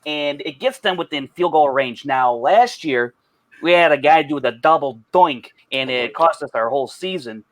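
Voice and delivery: thick, exaggerated Chicago accent. Shown as a demonstration. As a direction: lay on a heavy Chicago sports-fan accent. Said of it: "I'll do my Chicago accent".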